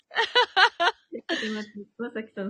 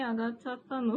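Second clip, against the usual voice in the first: ordinary talk, nothing marked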